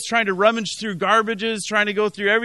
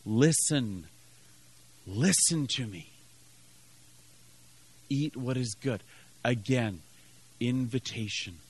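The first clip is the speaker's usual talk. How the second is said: low, soft pleading with the congregation; hushed appeal; 8 semitones lower, 9 dB quieter than usual. Real, not acted. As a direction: drop right down and let each phrase sink in.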